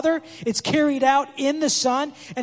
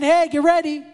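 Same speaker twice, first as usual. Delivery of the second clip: higher, louder call ahead of the king; herald's shout; 5 semitones higher, 4 dB louder than usual. Retold or imitated. Forerunner in remote areas calls out, higher and louder. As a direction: announce the coming king to the crowd.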